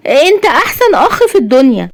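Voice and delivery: high-pitched delighted sister; happy. A narrator's reading. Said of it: "جيهان بسعادة". (بسعادة وبصوت عالي الطبقة)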